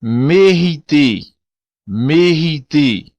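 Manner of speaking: mériter slow, with a throaty r; mériter, each syllable slow with a guttural r; Creole word. Shown as a demonstration. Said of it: "ainda mais acentuado no crioulo".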